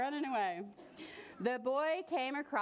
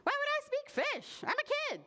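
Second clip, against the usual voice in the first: very high-pitched protest; indignant boy. Acted. Boy protesting to a tree, high indignant voice. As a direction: pitch it way up, incredulous.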